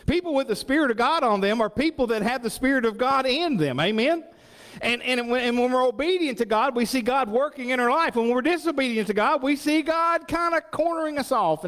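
high, loud preacher's build; preaching at full force. Acted. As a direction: drive the point home to the congregation.

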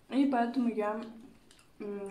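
speaking between bites; chewing while talking. Real, not acted. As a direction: mouth partly full of food. Seen 2: Bite taken at -2; lips pressed, cheeks full chewing until ~1.8.

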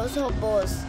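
usual speaking voice

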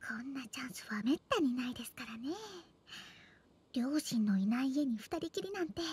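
scheming soft whisper; pillow talk. Acted. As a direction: whisper the plan under her breath.

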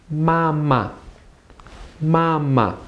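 "Mama" high and level, then dropping off; high-then-light tones on "Mama"; first tone then neutral. Shown as a demonstration. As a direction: hold the first syllable of "Mama" high, let the second fall light. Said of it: "The first part is first tone".

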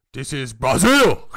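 loud, slow, gruff movie shout; Vin Diesel impression. Mocking Vin Diesel's line, loud slow shout. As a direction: bellow it like a hammy action hero. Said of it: "I remember him in one of the Fast and the Furious going".

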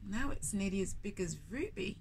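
put-on storyteller reading to children; story narration. A narrator's reading. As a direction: read it like a picture book to little ones. Reads the picture book aloud.

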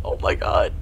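normal talking voice

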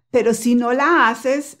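normal talking voice

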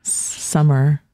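"summer" held on a long final R; drawn-out R. Shown as a demonstration. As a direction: show the R at the end of "summer". Shows the R sound to learner.

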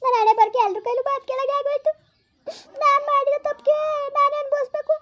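high, plaintive wail; self-pitying lament. Acted. Scolded wife blaming her own fate, high plaintive lament.

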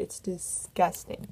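whispered in disgust. Real, not acted. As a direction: breathe it out, grossed out. Disgust at scotch.

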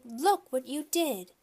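dismayed mother. A narrator's reading. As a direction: draw it out in dismay. Mother scolding birthday boy over cake.

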